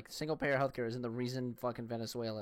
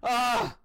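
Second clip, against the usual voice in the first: frustrated yell; 11 semitones higher, 10 dB louder than usual. Real, not acted. Frustrated outburst mid-sentence.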